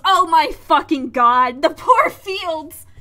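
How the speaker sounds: loud, slow dismayed wail; horrified outcry. Real, not acted. Reader watching the ship wreck the fields, horrified outcry.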